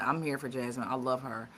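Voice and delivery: normal talking voice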